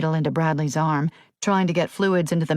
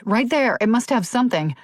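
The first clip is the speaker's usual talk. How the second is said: higher, eager exclamation; deputy exclaims about the closet; exclaiming; 6 semitones higher than usual. A narrator's reading. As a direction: point it out in a sudden rush. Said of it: "Rainey exclaimed".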